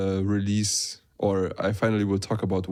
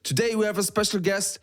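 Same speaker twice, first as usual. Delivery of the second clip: podcast host intro; 7 semitones higher, faster than usual. Acted.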